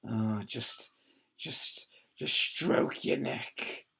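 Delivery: slow breathy creep voice; sleazy whisper. Retold or imitated.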